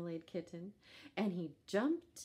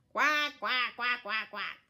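loud high quacks; duck quacking; 5 semitones higher, 10 dB louder than usual. A narrator's reading. Duck answering the kitten with loud quacks. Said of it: "And the duck went".

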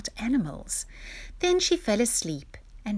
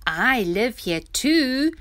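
lightly voiced rhino, louder and slow; slow, higher and loud, a hint of snorting rhino; 6 semitones higher, 7 dB louder, slower than usual. A narrator's reading. Rhino butting in to answer Dudu, lightly snorting. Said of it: "snorted the rhino".

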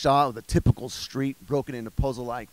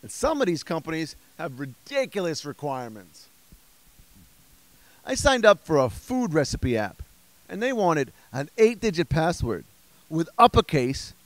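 normal talking voice

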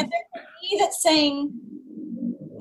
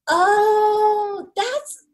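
mock gasp of shock; 8 semitones higher than usual. Woman mimicking her PMS self, gasping and high. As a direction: gasp then a high startled oh. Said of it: "it's like".

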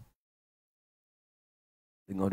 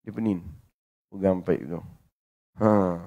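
ordinary talk, nothing marked

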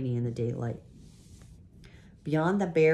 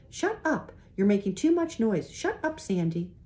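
put-on much higher, sharp girl's voice scolding; bossy girl scolding; 8 semitones higher than usual. A narrator's reading. Redheaded girl shoves the noisy boys and scolds them, high and sharp. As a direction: boss the boys into silence like a big sister.

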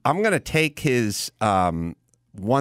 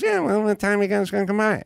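higher, fast eager voice; imitating Leno; 10 semitones higher, faster than usual. Host calling his friend on the phone, fast and chipper. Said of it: "he goes".

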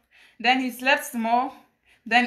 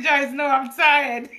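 slow, drawn-out tired refusal, laughing; hammed-up exhaustion through laughter; mock-weary man; 3 dB louder, slower than usual. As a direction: imitate a worn-out lover begging off, amused. Voicing the worn-out minister, amused.

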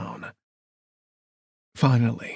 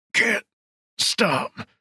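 higher, strained voice of a wounded man; strained killer; 8 semitones higher than usual. Acted. Wounded killer pinned by the throat, strained and high. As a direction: gasp it out, word by word.

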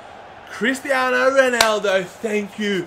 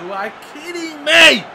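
higher, much louder incredulous yell; outraged shout; 7 semitones higher, 8 dB louder than usual. Real, not acted. Gamer shouting at his team for conceding, loud outrage. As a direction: explode at the screen in disbelief. Disbelief after conceding early goal.

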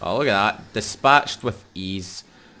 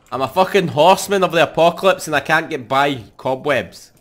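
louder, higher exasperated complaint; frustrated rant; 6 semitones higher, 9 dB louder than usual. Real, not acted. Horseman player stuck on cobwebs, indignant rant. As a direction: vent at the absurd obstacle.

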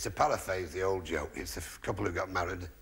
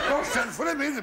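much higher, louder mock plea; urgent plea; 4 dB louder than usual. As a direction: beg them not to. Urgent plea voiced high.

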